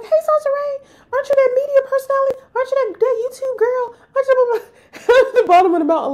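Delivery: high, fast, giggly fan voices gushing; excited fans. Retold or imitated. Strangers at the mountain spotting her, high excited gushing. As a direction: play starstruck strangers spotting a celebrity. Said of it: "They're like".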